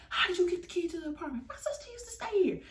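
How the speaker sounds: high-pitched, hammed-up back-and-forth; comic squeaky retelling of questioning; mock interrogation. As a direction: fire it out high, answer in a squeal. Acting rapid interrogation and panicked answer.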